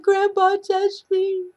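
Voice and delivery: put-on very high, loud wail of a child; imitated bawling. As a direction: draw it out in a sobbing cry. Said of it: "she's bawling".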